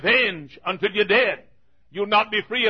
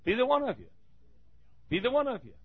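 softer, dropped repetition; quiet echo; 3 semitones higher, 8 dB quieter, much faster than usual. Acted. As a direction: let it sink in, softly. Quiet repeated emphasis.

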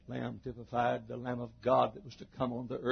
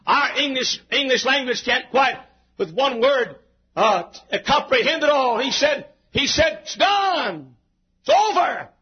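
high, exclaiming pulpit delivery; full-force preaching; 13 semitones higher, 10 dB louder than usual. Real, not acted. Preacher marvelling at one word, full-force preaching. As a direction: proclaim it high and hard.

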